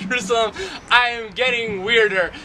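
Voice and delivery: usual speaking voice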